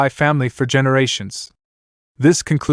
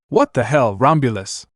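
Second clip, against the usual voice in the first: normal talking voice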